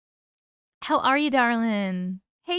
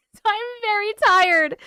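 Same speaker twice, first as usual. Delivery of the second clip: laughing it out, higher and louder; 10 semitones higher, 6 dB louder than usual. Real, not acted. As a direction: giggle through the words. Laughing about being tired on stream.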